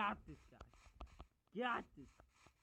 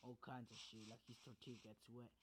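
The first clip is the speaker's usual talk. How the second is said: usual speaking voice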